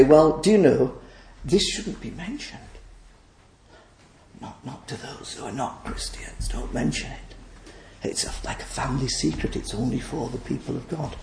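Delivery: mild, hushed imitation of objectors; hushed objection. Preacher mimicking those who say don't mention it, hushed. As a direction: drop to a confidential near-whisper. Voicing imagined objectors, secretive.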